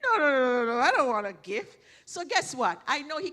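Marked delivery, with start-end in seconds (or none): 0.0-1.8 loud, rapid-fire protesting no's; emphatic refusal. Acted. Host mimicking the pastor refusing a gift, loud protest. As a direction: play the pastor loudly waving off a present. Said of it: "he's gonna say".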